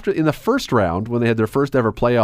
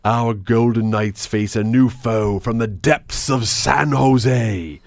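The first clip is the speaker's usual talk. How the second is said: slow, hammed-up epic announcer; over-the-top narrator; 2 semitones lower, much slower than usual. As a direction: draw it out like an epic trailer. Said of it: "a narrator who was way over the top".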